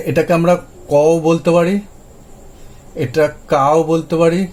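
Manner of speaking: ordinary delivery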